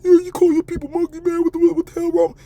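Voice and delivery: high put-on complaining voice; mock viewer voice. Streamer imitating an offended viewer, high mock voice. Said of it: "yeah, I call 'em monkeys".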